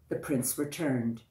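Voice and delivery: plain reading voice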